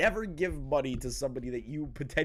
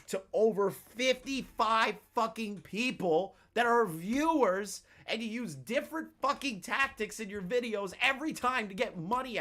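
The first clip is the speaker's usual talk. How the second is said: indignant rant; 8 semitones higher than usual. Real, not acted.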